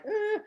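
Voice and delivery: a nervous high little "eh"; scared hesitation. Retold or imitated. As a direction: hesitate nervously before asking. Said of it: "I do it scared".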